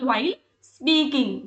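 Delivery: speaking stretched slow and pressed hard; stress on speaking. Shown as a demonstration. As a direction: lean on the word speaking, drawn out. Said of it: "मैंने speaking पे यहाँ पे stress दे दिया". (speaking शब्द पर ज़ोर, धीरे खींचकर)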